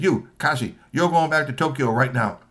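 angry boss. Acted. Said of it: "He said".

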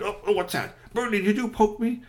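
startled yelp. Acted. Rocky gets poked.

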